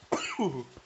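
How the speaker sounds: harsh hacking cough; coughing fit. Real, not acted. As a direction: cough hard, out of control. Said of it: "went down the wrong pipe".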